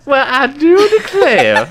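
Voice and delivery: very high, slow laughing Southern belle; mock Southern accent. Host laughing back in a mock Southern belle accent. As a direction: echo the belle line through laughter. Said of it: "you're making fun of ... by using that accent".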